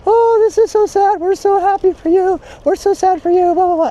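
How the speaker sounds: high, mock-sweet sympathy voice; gushing chat commenters. Mocking Instagram commenters in a high saccharine voice. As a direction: parody the syrupy online comments. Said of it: "all the people in the chat".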